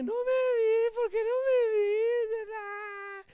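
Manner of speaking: loud, high exaggerated bawling; hammed-up wailing whine, far above normal pitch; mock crying. New wife nagging Samson for seven days, mock crying. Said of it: "su nueva esposa está llore y llore".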